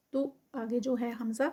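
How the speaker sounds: usual speaking voice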